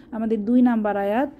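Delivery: usual speaking voice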